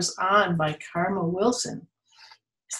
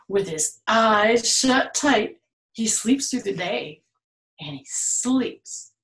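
plain reading voice

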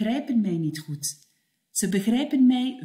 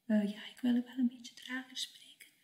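fast breathless whisper; 3 semitones higher, 10 dB quieter, much faster than usual. Acted. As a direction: whisper it so it can't be heard. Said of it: "ik hoor jou niet". (fluisterend en snel)

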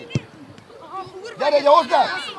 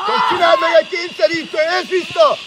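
quick, raised sideline shout; shouted instruction; 4 semitones higher, 4 dB louder, faster than usual. Real, not acted. Coach tells player to make a move, shouting. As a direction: fire it out urgently over the pitch.

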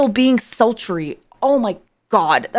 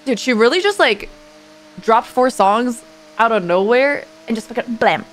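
normal talking voice

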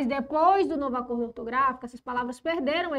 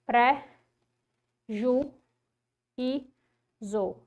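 each syllable alone, pause between; measured syllable breakdown; much slower than usual. Shown as a demonstration. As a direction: split the word into spoken syllables. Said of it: "quando a gente separa".

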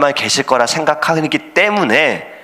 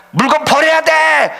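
panicked shouting. Acted. Sailors yelling to throw cargo overboard, panicked shout. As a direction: yell at the crew in a panic. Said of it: "이렇게 난리를 치고 있는데".

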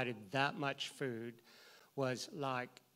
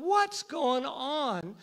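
baffled; 11 semitones higher, 7 dB louder, much slower than usual. Retold or imitated. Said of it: "And I'm going".

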